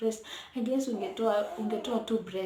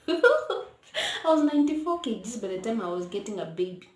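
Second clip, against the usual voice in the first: laughing through the words; 3 semitones higher, 8 dB louder than usual. Real, not acted. Laughing as she tells viewers her weight. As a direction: giggle while telling the weight.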